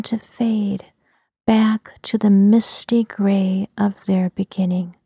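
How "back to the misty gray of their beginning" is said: ordinary delivery, nothing marked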